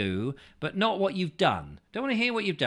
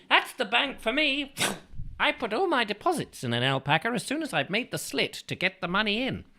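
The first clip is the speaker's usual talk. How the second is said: silly voice; 8 semitones higher, 3 dB louder than usual. Pretend. Silly voice reading alpaca message.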